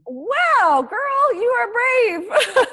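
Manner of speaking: high, loud and slow, really impressed; excited exclamation. Real, not acted. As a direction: gush with delight, stretching it out. Host impressed by guest's move.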